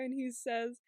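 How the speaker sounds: usual speaking voice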